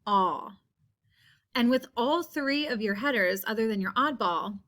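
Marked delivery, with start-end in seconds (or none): 0.1-0.6 drawn-out open aw; isolated aw sound. Shown as a demonstration. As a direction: hold the vowel aw on its own. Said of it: "we are listening for a sound".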